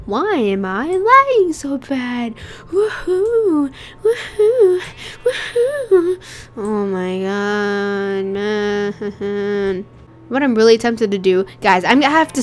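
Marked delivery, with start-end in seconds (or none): 0.1-2.3 higher, drawn-out whine; whiny complaint. Real, not acted. Wailing complaint about the lag. 2.7-6.2 high-pitched fake sobbing wails; boo-hoo in exaggerated, rising wails; mock crying. Mock crying over the lag. 6.6-9.9 long, slow, monotone groan; mock-crying moan. Mock crying over the lag.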